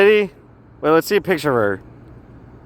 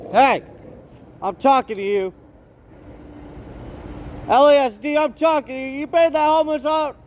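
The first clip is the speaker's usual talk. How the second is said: high-pitched yell at people down the street; heckling call, pitched way up; 7 semitones higher than usual. Real, not acted. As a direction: shout it down at them from above. Yelling down at sheriffs outside.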